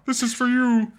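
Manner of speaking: very high squeaky puppet voice; Miss Piggy voice. Pretend. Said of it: "Wish I could do a Miss Piggy voice".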